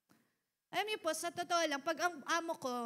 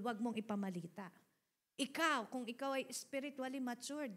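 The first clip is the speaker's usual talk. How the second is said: normal talking voice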